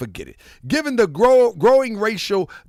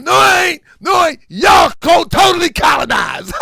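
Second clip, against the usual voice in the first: loud protest; 11 semitones higher, 8 dB louder than usual. Real, not acted. Shouting back at the colonized.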